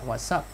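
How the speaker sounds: "what's" with a hissed s; voiceless S ending. Shown as a demonstration. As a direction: link "what's" to "up" through a clear s. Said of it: "phát âm là s".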